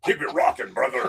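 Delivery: high raspy wrestling promo growl; Hulk Hogan voice. Retold or imitated. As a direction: cut a promo like the Hulkster. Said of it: "You could do the Hulkster".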